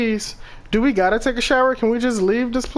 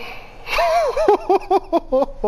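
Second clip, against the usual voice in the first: nervous laugh; 11 semitones higher than usual. Real, not acted. Nervous reaction to a scare.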